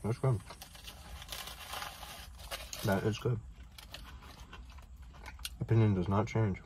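talking between bites; chewing while talking. Real, not acted. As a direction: mouth full of food. Seen 0: Chews with lips closed throughout; takes more bites at 9-12s.

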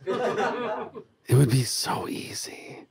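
slow, sly whisper through a laugh; gleeful whisper. Real, not acted. Host to listeners, savouring a dark joke, whispered and slow. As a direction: breathe it like a delicious secret. Gleeful murder fantasy aside.

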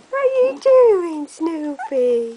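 high, loud sing-song; puppy talk. Real, not acted. Mum cooing at puppy Snoopy, high sing-song. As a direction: coo at the dog.

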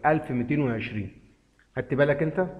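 ordinary talk, nothing marked

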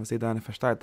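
usual speaking voice

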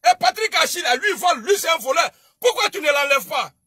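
loud, high accusing shout; angry shout. Real, not acted. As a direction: call him a thief loudly. Accusing a rival of theft. (accusation criée, forte et aiguë)

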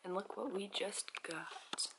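soft early-morning whisper. Real, not acted. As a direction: whisper so no one wakes. Whispering early in hotel room.

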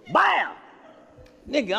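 ordinary talk

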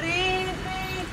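high, soft sustained ring; imitated phone ring. Phone still ringing in the same pocket, voiced faint ringing.

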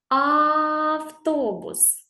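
slow, high "Автобус", the "А" held out; stretched first sound. Shown as a demonstration. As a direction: draw out the first vowel of "Автобус" on one note. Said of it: "Ще разочок".

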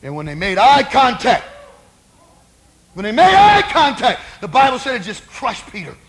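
loud, rising sermon cry; impassioned preacher at the climax; full-force preaching. Real, not acted.

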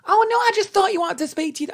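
high, quick mum voice; put-on high, hurried mum; imitated mum excusing herself. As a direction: rattle off an excuse, high and fast. Mum's excuse acted out, high.

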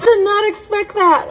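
high, laughing through words. Real, not acted. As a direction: laugh off the surprise. After sour bite.

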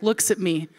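normal talking voice

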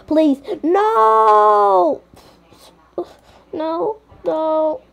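high panicked begging please no; panicked pleading. Pretend. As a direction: beg for your life. Being attacked, pleading.

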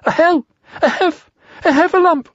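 very high, panicked stuttering squeaks; out of breath. A narrator's reading. Piglet telling Christopher Robin of danger, breathless high panic. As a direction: gasp out the word in broken attempts. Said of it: "breathing so hard that he could hardly speak".

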